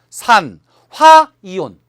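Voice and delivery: 산, 화, 이온 piece by piece, 화 loud and held; stress on 화. Shown as a demonstration. Teacher building oxygen's ion name, stress on 화. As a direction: separate 산 and punch a long 화 before 이온. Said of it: "화자를 주로 붙여요".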